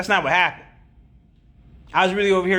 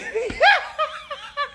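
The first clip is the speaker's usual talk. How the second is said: high laugh. Real, not acted. Laughing at chat comment.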